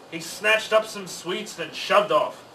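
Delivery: ordinary delivery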